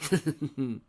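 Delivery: short evil giggle; villain giggle. Acted. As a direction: giggle with glee at the plan. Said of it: "He gave a giggle".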